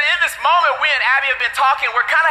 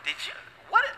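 mock shocked dad; 10 semitones higher, 9 dB quieter than usual. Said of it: "And I was like".

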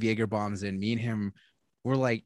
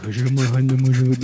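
imitating a drunk friend, louder and slurred; mock drunk slur; 8 dB louder than usual. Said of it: "he's mumbling and slurring".

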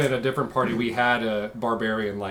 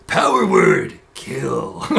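much lower, slow wrestler growl; pro wrestler voice; 4 semitones lower, 4 dB louder, much slower than usual. Acted. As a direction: drop it low like a wrestling promo. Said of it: "a wizard character who talks like a pro wrestler".

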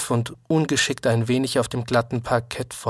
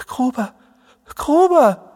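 high calls, the second much louder; helpless calling; 14 semitones higher, 6 dB louder than usual. A narrator's reading. Jerome helplessly calling his unconscious friend, crying out. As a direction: call the name helplessly, rising to a cry. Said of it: "Jerome steht ratlos mit hängenden Händen und ruft".

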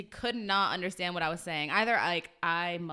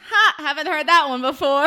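loud, higher sarcastic fake-laugh reply; mock amusement; 5 semitones higher, 11 dB louder than usual. Server answering a customer's stupid joke, loudly sarcastic. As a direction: belt out a hammed-up "never heard it".

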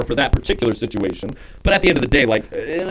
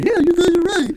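very high, silly yes-man voice; hammed-up high-pitched agreeing; mock agreement; 14 semitones higher than usual. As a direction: squeal it like a spineless pushover. Mocks agreeing with everyone on podcast.

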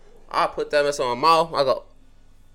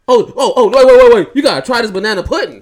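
loud, raised, hurried pleading; urgent stopping; 6 semitones higher, 11 dB louder than usual. Acted. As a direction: imitate someone frantically halting a friend. Acts Diamond urgently stopping him.

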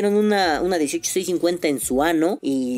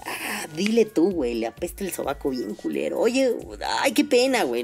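embarrassed friend. Pretend.

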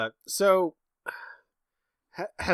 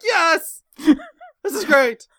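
high, loud, drawn-out joy; hammed-up delight; mock glee; 8 dB louder, much slower than usual. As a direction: cheer with a mouthful of corn.

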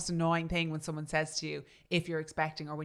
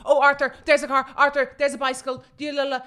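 louder, higher fussy backseat driver; nagging passenger; 7 semitones higher, 11 dB louder than usual. Retold or imitated. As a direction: fret at every car like Hyacinth. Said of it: "You're like".

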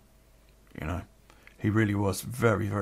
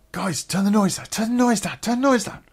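raised, higher-pitched appeal over party noise; calling over noise; 10 semitones higher than usual. Retold or imitated. Man on the phone to noisy party guests, calling out. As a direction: plead with the room to quieten down.

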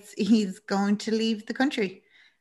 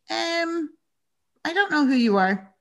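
high-pitched hesitant imitation of a wary man; hesitate, then answer with pitch raised; mock bewilderment at a stranger's offer; taken aback; 8 semitones higher than usual. Voices man taken aback by proposition.

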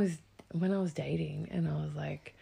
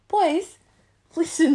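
put-on high, loud call to the boys; calling for attention; 12 semitones higher, 13 dB louder, slower than usual. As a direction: demand the boys listen, loudly. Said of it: "she's like".

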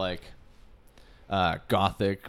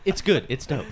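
imitating catchphrase; 3 semitones higher, 4 dB louder than usual. Mimicking Zach's catchphrase.

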